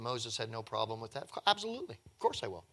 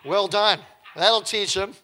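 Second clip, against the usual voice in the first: loud mock congratulation, higher; sarcastic praise; 6 semitones higher, 10 dB louder, slower than usual. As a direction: cheer them on with heavy sarcasm. Mocking the magicians' useless feat.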